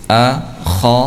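kho with lips pushed forward; rounded kho. Shown as a demonstration. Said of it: "dengan memonyongkan bibir ke depan".

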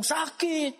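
high-pitched imitation of a child's "ow"; child in pain. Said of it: "Terus dia bilang".

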